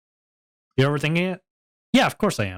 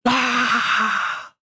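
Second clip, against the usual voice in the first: high yelp; 11 semitones higher than usual. Real, not acted. Exclaims after laughing.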